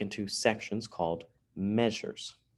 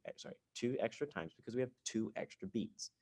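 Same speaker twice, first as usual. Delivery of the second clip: ordinary delivery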